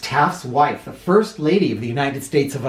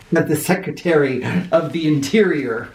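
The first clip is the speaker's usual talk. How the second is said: ordinary delivery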